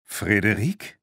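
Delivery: fragend ansteigend, erstaunt; überrascht. A narrator's reading. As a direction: den Namen verwundert erfragen. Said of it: "rief sie überrascht". (überrascht)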